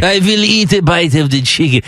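badly faked French accent; cartoonish attempt at a French accent; horrible French accent. Shown as a demonstration. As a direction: do a clumsy mock-French voice. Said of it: "That's my horrible French accent".

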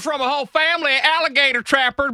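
high, hammed-up Southern yokel; exaggerated Southern twang; Southern accent. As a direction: play a backwoods Southern rocket man. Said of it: "that accent has been used".

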